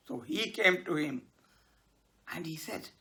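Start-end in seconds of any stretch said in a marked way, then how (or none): none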